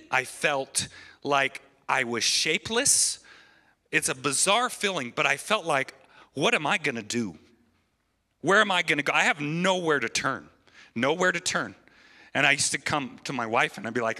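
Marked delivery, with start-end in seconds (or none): 6.3-11.7 panicked thoughts. Retold or imitated. Retelling desperate thoughts.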